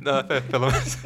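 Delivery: ordinary delivery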